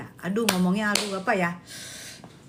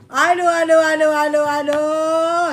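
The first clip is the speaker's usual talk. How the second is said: loud repeated groans; 5 dB louder than usual. Real, not acted.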